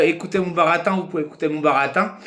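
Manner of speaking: usual speaking voice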